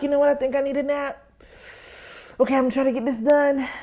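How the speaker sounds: a light put-on sleepy tone; mock tired. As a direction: fake a tired sigh of a voice. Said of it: "I'm like".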